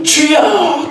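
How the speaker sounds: high, ringing prayer shout; crying out in prayer. Shown as a demonstration. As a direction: demonstrate a communal prayer cry. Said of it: "부르짖는 기도예요".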